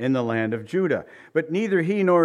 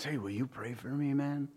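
mock confidential plea, much softer; hushed request; 10 dB quieter than usual. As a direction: ask quietly, as if on the sly. Scornful coworker privately asks for prayer.

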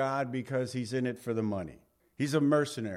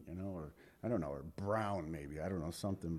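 mumbled aside; 9 semitones lower, 12 dB quieter than usual. Real, not acted. Joking aside, trailing off.

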